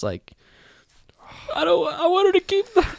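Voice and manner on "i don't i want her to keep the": very high-pitched, hammed-up whine; mock pleading. Host mimicking a character begging about the scarf, mock pleading. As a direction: imitate a needy plea in a squeaky high voice.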